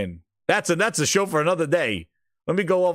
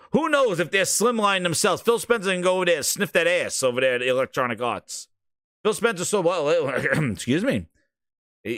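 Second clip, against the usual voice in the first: ordinary delivery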